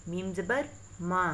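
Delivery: ordinary talk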